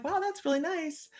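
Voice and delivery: high, soft mock-impressed wow. Retelling finding an umbrella at the door, soft high wow. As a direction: play your past self pleasantly surprised. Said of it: "And I'm like".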